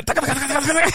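high-pitched rattling scurry sound; comic squeaky getaway patter; imitated running noise. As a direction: rattle off fleeing footsteps in a high voice. Imitates his hurried escape.

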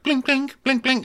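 very high plinking notes; plucked violin sound. Pretend. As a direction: mimic nervous plucked strings. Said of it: "very nervous violins".

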